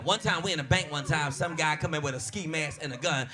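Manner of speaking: ordinary delivery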